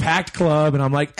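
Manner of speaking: ordinary talk, nothing marked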